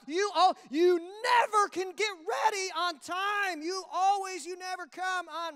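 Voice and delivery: loud, high, drawn-out scolding; hammed-up exasperated nag, stretching the key words; nagging spouse. Spouse scolding about clothes on the floor, exasperated.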